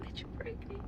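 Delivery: voiceless, hushed; whispered question. Retold or imitated. Friend asking during a hug, whispering. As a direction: lean in and ask in a whisper.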